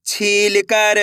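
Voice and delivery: छील कर with long stretched vowels; blended syllable reading. Shown as a demonstration. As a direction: draw out छील कर for learners. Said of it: "एक अक्षर का उच्चारण सही से". (छील कर को खींचकर)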